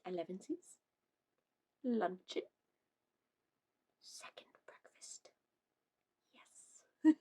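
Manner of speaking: hushed dreamy whisper. Real, not acted. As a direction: whisper it longingly.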